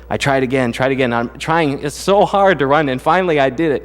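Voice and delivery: ordinary delivery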